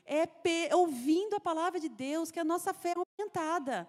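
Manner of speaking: preaching loud and high, full force; pregação. Real, not acted. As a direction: preach it with conviction to the sisters. (pregação em voz alta e intensa)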